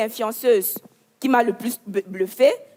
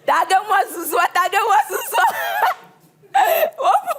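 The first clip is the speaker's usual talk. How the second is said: high, giggly, excited calls; laughing and shouting; 9 semitones higher than usual. Acted. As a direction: squeal with gossip delight. Gossip influencer hyping the scandal.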